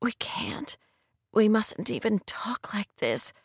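hushed plea, opening almost whispered; soft cry. A narrator's reading. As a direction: protest under the breath. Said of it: "she said with a soft cry".

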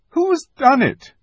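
very high distressed cry. A narrator's reading. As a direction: demand through grief. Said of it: "cried Susan".

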